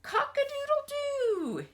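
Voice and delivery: loud, very high rooster crow. Acted. Teacher crowing like the rooster for the children.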